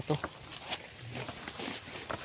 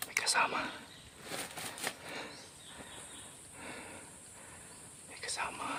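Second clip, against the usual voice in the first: very soft, whispered warning; real hushed whisper; secretive whisper; 17 dB quieter than usual. Real, not acted. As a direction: whisper it to alert your partner unnoticed. Spotting people while hiding.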